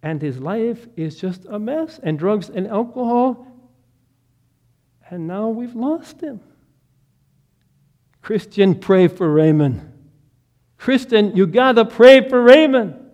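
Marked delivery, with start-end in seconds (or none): 2.2-9.8 loud, urgent plea; pleading father. Retold or imitated. Sobbing father begging Anna to pray, loud plea. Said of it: "He's just sobbing uncontrollably". 10.8-13.0 higher and much louder, crying out; desperate cry. Retold or imitated. Desperate father crying out to Anna, high and loud. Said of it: "He's just sobbing uncontrollably".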